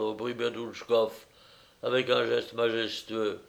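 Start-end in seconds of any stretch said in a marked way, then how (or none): none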